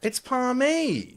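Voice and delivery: Palmy said slow and high; drawn-out high Palmy. Shown as a demonstration. Debating name pronunciation.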